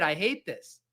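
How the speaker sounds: normal talking voice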